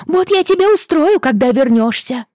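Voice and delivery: high, loud furious threat; angry scolding. Acted. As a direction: shout the threat at the dog. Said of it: "ругалась Рэйчел". (сердито кричит на пса)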